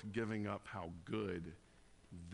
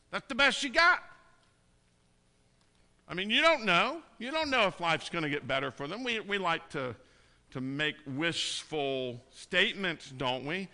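much louder and higher, almost shouting at the crowd; fiery preaching; 6 semitones higher, 8 dB louder than usual. Acted. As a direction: call out the empty comfort with heat. Challenging worldly comfort, preaching hard.

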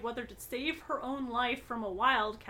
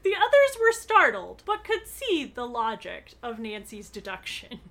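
usual speaking voice

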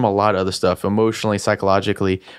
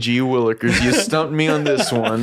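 high, hokey golly-gee voice; fake bashful aw shucks; 5 semitones higher than usual. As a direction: gush it like a corny country boy. Mock God, joking.

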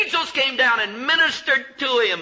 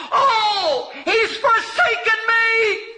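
very high, slow, crying wail; preacher voicing Jesus, near tears; Jesus' anguished cry; 13 semitones higher, 3 dB louder, much slower than usual. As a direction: break into a sob on the forsaken words. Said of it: "And Jesus says".

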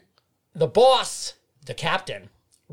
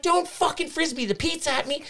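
much higher alarmed protest; alarmed plea; 11 semitones higher than usual. Retold or imitated. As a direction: beg him not to throw it. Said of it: "And I'm like".

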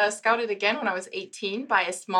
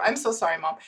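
ordinary delivery

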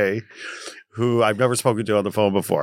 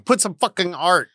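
lightly voiced walls, pitched up and drawn out; a higher, slower demand from the walls; talking walls; 6 semitones higher, slower than usual. Bare walls demanding art from their owner, higher and drawn out. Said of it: "your walls are talking and they're saying".

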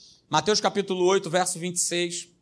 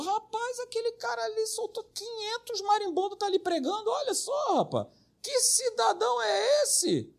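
much higher, softer mocking voice of a visitor; gossiping churchgoer; 12 semitones higher, 8 dB quieter than usual. Churchgoer mocking the pastor to a friend, high and snide. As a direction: mimic a snide visitor whispering about the preacher. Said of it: "o cara entra aqui e falou".

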